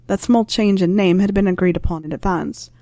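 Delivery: ordinary delivery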